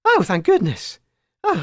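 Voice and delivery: usual speaking voice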